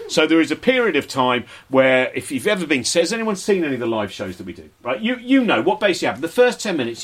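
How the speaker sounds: ordinary talk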